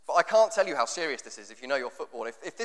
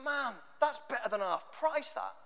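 higher, mocking lads' banter voice; higher imitation of a lad bragging about a bargain; lad at the airport; 7 semitones higher, 8 dB quieter than usual. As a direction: take off an excited lad spotting cheap vodka. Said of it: "He went".

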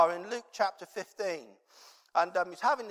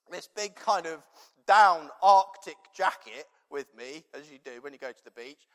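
ordinary delivery

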